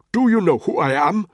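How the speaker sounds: put-on loud, high furious Nigerian accent; outraged shout. Ntoga furious at Tony, outraged shout. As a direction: demand respect.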